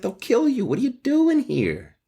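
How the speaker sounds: shrill panicked teen, playing it up; hammed-up frightened girl; panicked Juliet. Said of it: "She's like".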